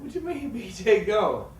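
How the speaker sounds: high, loud, slow disbelief with a laugh; incredulous laugh. Retold or imitated. Told his player left, laughing disbelief.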